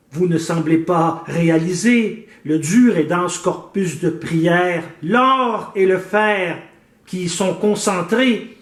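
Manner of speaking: measured, declaimed verse with drawn-out line endings; poem recitation. Acted. Poet declaims the theft of the psalter to the suspects, theatrical recitation. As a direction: recite it theatrically, pausing after each line.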